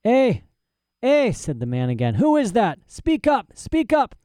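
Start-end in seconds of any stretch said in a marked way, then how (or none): 0.0-1.3 high, loud querulous "eh"; calling out. A narrator's reading. Old prisoner calls to unseen intruder, querulous. 2.1-4.1 put-on high-pitched, agitated old man; demanding old prisoner. A narrator's reading. Prisoner challenges hidden intruder, agitated and insistent.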